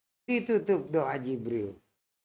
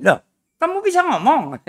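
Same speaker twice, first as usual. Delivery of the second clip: high, loud shocked question; can't believe he speaks; loud startled disbelief; surprised; 10 semitones higher, 9 dB louder than usual. Acted. Stranger shocked Dulah speaks.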